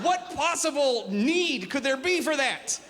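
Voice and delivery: high, drawn-out incredulous protest; slow down and wail each word in disbelief; exasperated outcry. Acted. Comedian protesting pointless teenage oily skin, exasperated.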